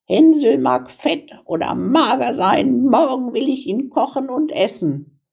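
high-pitched witch giving orders; high, impatient witch voice. A narrator's reading. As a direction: boss the girl about as a wicked witch. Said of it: "rief sie dem Mädchen zu".

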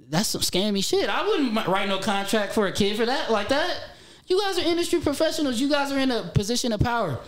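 high-pitched, indignant rant; real indignation. Real, not acted. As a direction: call out the people responsible, voice climbing. Indignant rant at industry professionals.

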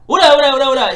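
loud, rising cries of alarm. Real, not acted. Alarmed by a confusing bonus stage, loud cries. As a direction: yelp in surprise at the screen.

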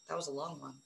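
ordinary talk, nothing marked

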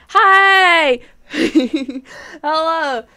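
high loud excited hi and hello; squealed greeting. Real, not acted. Streamer greeting a viewer's dragon, squealing with joy. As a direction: squeal a delighted welcome.

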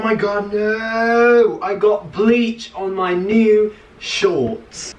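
high, slow dismayed wail; real upset, drawn out. Real, not acted. Bleach on new shorts.

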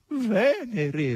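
high, falling whine; plaintive whimper; plaintive boar. A narrator's reading. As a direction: moan it pitifully. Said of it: "rispose lamentosamente il verro".

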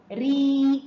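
loud, sustained ري; long vowel demo. Shown as a demonstration. Teacher leads pupils repeating along, drawn-out ري. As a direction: hold the ee of ري on one long note. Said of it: "هيبقى صوته إيه؟".

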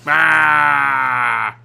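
drawn-out charging shout; mock attack roar. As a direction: bellow one sustained war cry. Attacks the van.

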